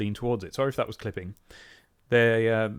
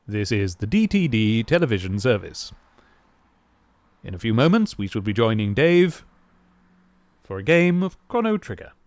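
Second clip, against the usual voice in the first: measured, formal BBC-style station announcement; TV continuity announcer; 4 semitones lower, 3 dB louder than usual. Pretend. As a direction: introduce the show like a children's TV continuity presenter. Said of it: "I'm doing that voice".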